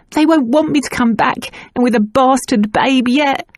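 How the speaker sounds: tearful confession. A narrator's reading. Nan crying, confessing.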